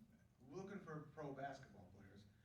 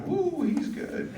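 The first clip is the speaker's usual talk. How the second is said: high, slow, drawn-out cooing praise; impressed family; 21 dB louder, much slower than usual. Retold or imitated. Family in the car praising singing child, impressed. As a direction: imitate a carful of admiring relatives.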